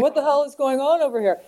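high, fast demand; alarmed confrontation. Retold or imitated. Men who think a woman was knocked down, alarmed confrontation. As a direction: rush in demanding an explanation.

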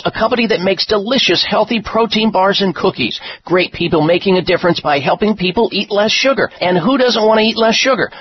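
bright, punchy ad read; infomercial. Acted. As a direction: sell it with energy. Energetic sponsor ad.

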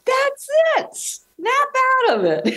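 high, mock Cher snap; imitating Cher. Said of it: "I loved when she said that. I go".

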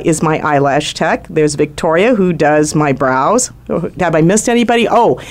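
ordinary delivery

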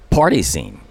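usual speaking voice

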